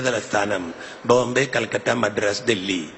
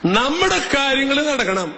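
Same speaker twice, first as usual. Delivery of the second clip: high, slow, drawn-out mock plea; mock pleading demand; 14 semitones higher, 3 dB louder than usual. Preacher voices selfish worshippers as a high slow plea. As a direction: stretch it out like a greedy prayer.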